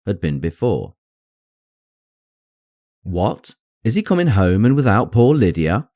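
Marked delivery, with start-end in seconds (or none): 3.0-5.9 raised, alarmed cry; cried out. A narrator's reading. Elizabeth to her aunt, crying out in alarm. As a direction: cry out in dismay. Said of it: "she cried".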